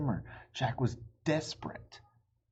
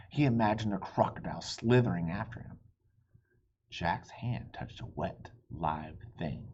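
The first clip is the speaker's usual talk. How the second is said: plain reading voice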